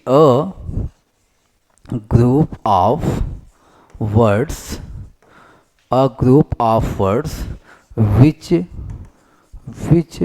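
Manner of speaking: word by word, with long pauses; slow dictation. Real, not acted. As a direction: dictate it slowly as you write it out. Dictates definition while writing it out.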